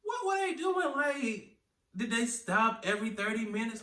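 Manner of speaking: very high-pitched disbelief; incredulous retold reaction. Reacting to an eight-hour bus ride, high-pitched incredulity. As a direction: squeak the questions out, baffled. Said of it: "I was like".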